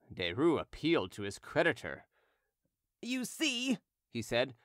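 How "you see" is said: high, softer pleading aside; soft appeal. Acted. Derues appealing to his creditor, soft high plea.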